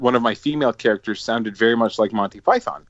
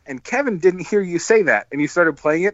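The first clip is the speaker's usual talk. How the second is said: normal talking voice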